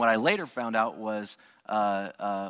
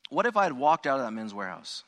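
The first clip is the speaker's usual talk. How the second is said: ordinary talk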